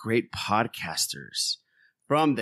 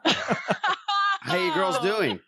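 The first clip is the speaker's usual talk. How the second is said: laughing greeting; much slower than usual. Real, not acted. Host greets guests laughing.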